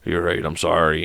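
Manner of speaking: low, soft acted concession; imitated subdued sorry; quiet apology. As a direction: give in softly, voice dropped. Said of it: "just quiet as all hell".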